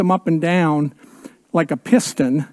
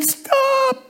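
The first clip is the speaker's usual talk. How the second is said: coaches yelling stop; 5 dB quieter than usual. Retold or imitated. Coaches call to Todd as he runs, high yell. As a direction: cry out stop to the running kid. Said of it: "his team is yelling and screaming".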